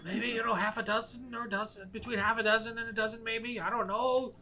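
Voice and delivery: rattled prisoner. Acted. Prisoner guessing numbers under threat of torture, high and evasive.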